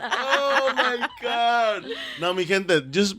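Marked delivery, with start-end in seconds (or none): 0.0-1.9 slow, drawn-out laugh-cry. Real, not acted. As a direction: stretch it out while laughing. Laughing at the dark joke.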